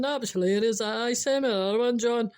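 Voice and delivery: broad Scottish take on an amused boss. Acted. Boss imagined replying to John, broad Scottish. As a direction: lean into the Scots, brisk and hearty. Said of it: "wee Scottish bit in it".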